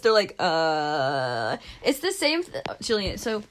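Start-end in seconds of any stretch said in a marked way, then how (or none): 0.4-1.6 long low, blank "uh"; imitating a stumped, drawn-out hesitation; unsure response. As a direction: drone it out low, lost for an answer. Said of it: "not sure how to respond".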